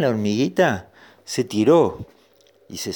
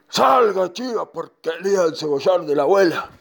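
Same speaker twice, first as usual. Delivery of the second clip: horse ordering goat out; 8 semitones higher than usual. Acted. Horse ordering the goat out of grandma's onion patch, commanding. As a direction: call it out forcefully at the goat.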